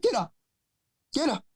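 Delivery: high-pitched urgent imitation of girlfriend. Girlfriend rousing him from bed, high urgent imitation. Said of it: "She's like".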